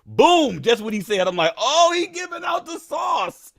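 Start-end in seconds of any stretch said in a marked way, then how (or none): 1.6-3.5 excited realisation. Retold or imitated. Trader recalling Trump's tip, high slow excited shout. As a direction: shout it like you just struck gold.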